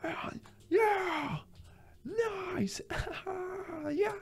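very high, soft mock cheer; high, airy drawn-out reaction from passers-by; imitating onlookers. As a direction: mimic strangers cooing approval, soft and high. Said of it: "people were like this".

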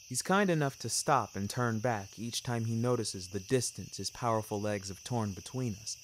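plain reading voice

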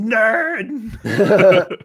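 high, drawn-out mock heckle; playful shout. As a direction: holler it as a teasing jeer. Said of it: "I just felt like shouting".

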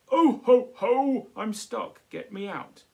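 loud, high calls for help; stuck man's shout. Acted. Santa stuck in the chimney, shouting to get out. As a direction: shout to be freed. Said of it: "then turns to a shout".

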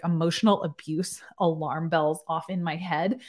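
ordinary talk, nothing marked

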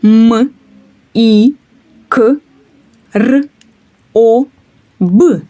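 М, І, К, Р, О, Б each named alone with pauses; sounds one by one. Shown as a demonstration. Teacher naming each sound for the class. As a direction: spell out each sound of «мікроб» separately. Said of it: "Давайте їх назвемо".

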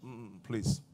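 lightly imitating someone too scared to be loved; timid refusal. Mimics fearful divorced person.

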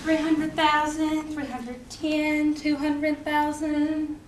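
very slow, measured tally of the dead; slow counting. Acted. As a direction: drag out each number. Counting dead soldiers slowly.

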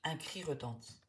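ordinary delivery, nothing marked

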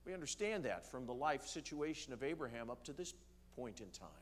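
ordinary talk

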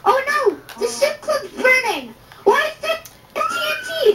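high-pitched, put-on alarm; mock panic. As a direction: cry out in feigned dismay. Pretend alarm at trolled build burning.

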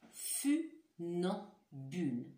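Funambule drawn out very slowly, each syllable clear; slow careful word. Shown as a demonstration. Teacher slowly repeats funambule so pupils hear the sounds. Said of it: "vous me disiez si vous entendez".

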